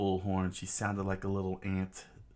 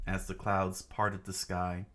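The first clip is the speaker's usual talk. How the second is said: ordinary delivery, nothing marked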